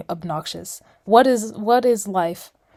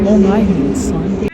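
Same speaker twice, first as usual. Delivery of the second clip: much louder line quoted back; quoting New Girl; 9 dB louder than usual. Retold or imitated. Friend echoes the New Girl quote loudly back. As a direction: shout it back like the sitcom line. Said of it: "It's a line from New Girl".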